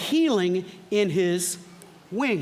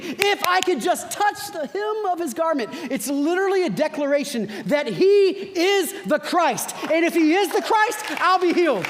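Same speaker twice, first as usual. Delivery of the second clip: impassioned declaration; 7 semitones higher than usual. Acted. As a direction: cry it out like a desperate believer. Preacher voicing her declaration.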